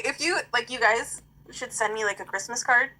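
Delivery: normal talking voice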